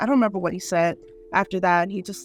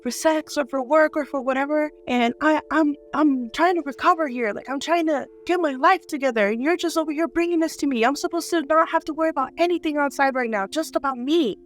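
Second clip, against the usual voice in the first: retold angry rant, pitched higher; going off; 7 semitones higher than usual. Patient confronts visitor for bringing work to her, going off. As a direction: vent in a high, exasperated stream. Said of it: "I go off on him".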